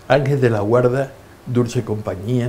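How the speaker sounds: ordinary talk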